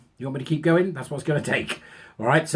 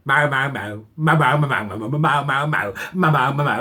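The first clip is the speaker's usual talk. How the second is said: repeated mow mow as a droning speaker; mocking wah-wah noise to drown out a lecture; 2 semitones lower than usual. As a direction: drone on like a buzzing speaker. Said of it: "somebody should stick on a speaker".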